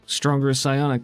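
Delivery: ordinary talk, nothing marked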